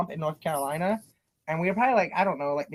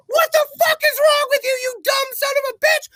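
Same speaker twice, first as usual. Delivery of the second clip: very high, loud screaming tirade; hammed-up enraged yell; furious dad; 8 dB louder than usual. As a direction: imitate a father shrieking at his kid. Retold dad yelling at son.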